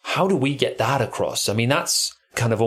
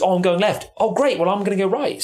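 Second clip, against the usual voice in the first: friendly call; 7 semitones higher than usual. Shown as a demonstration. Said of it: "who you don't know goes".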